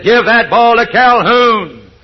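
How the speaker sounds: a coach near the end of his rope; angry coach shout. Retold or imitated. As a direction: bellow the order at the quarterback. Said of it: "he got a little more upset".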